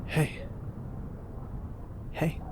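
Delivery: normal talking voice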